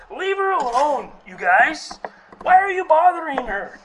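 loud, high rebuke of the disciples; Jesus rebuking. Retold or imitated. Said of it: "it's where Jesus says".

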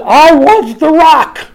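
high, loud, drawn-out declaration; emphatic proclamation. Acted. Preacher voicing Jesus, emphatic loud proclamation. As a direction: proclaim it slowly at full voice.

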